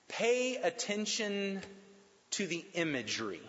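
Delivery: slow it down, lean on pay; slow emphatic point. Shown as a demonstration. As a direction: pay attention to the imagery, pay drawn out slowly. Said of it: "Third thing".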